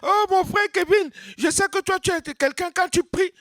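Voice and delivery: high, fawning praise of the praying brother; mock flattery. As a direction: lay it on thick, voice way up. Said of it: "Ne dis pas".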